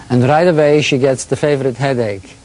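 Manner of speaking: put-on light Eastern European accent for a quote; quoting an old man in an Eastern European accent. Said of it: "who has got a thick Eastern European accent".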